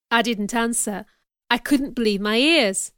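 usual speaking voice

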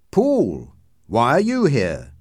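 surprised. A narrator's reading. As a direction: greet an unexpected visitor with surprise. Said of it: "asked, surprised".

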